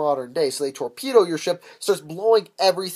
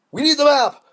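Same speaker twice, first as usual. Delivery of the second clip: loud, high mock shout; hammed-up interrogator yelling; pirate's demand; 12 semitones higher, 9 dB louder than usual. Pirates demand map from captive.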